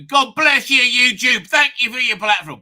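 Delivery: loud, high mock gratitude; sarcastic gushing. Host thanks YouTube after its ad-blocker complaint, loud sarcastic gushing.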